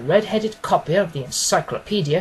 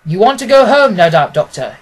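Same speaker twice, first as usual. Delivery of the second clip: louder, brisk and commanding detective; a keen detective, louder and decisive; Holmes voice; 10 dB louder than usual. Acted. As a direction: speak as the sharp, confident Holmes instructing his friend. Holmes speaking in the story.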